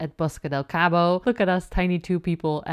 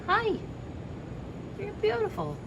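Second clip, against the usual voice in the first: much higher, gentle coo; pet talk; 7 dB quieter than usual. Real, not acted. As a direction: coo softly at the animal. Talking to a wild creature.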